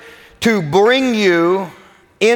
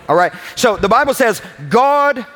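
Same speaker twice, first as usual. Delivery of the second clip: normal talking voice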